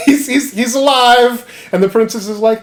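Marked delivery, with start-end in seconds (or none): 0.0-1.5 wheezing laugh, high, words squeezed out; real giggling fit. Real, not acted. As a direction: try to talk while cracking up. Laughing through retelling.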